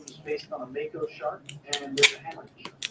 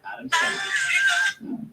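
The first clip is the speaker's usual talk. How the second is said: stretched, much higher name call; drawn-out high announcement; 7 dB louder, much slower than usual. Acted. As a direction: draw the name out high and slow.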